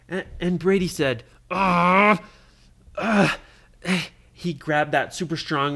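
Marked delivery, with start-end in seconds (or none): 1.5-3.4 loud, higher-pitched "ah" of effort; two loud, frustrated grunts; frustrated yell. Acted. Trapped boy cries out for his brother to hear, frustrated yell.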